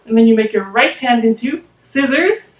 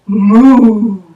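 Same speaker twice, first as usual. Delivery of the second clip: cow moo; 2 semitones lower, 6 dB louder than usual. Shown as a demonstration. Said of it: "what does the cow say".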